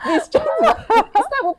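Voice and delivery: high, loud laughter through the words; laughing hard. Real, not acted. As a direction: laugh in disbelief mid-quote. Laughing at boyfriend's reply.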